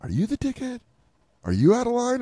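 high-pitched self-questioning; inner doubting voice. Retold or imitated. Voicing his own doubts mid-argument, high questioning voice. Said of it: "that's the voice in my head".

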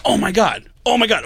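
retold starstruck excitement; excited gushing. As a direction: play an excited fan making an introduction. Nick excitedly introducing comedian, retold.

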